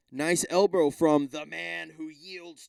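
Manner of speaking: slow, drawn-out fight announcer; mock fight commentator, hammed up; announcer call.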